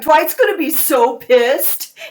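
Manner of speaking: loud, high, slow teasing; hammed-up voice of a commenter stirring trouble; gleeful fan comment. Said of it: "Or they write".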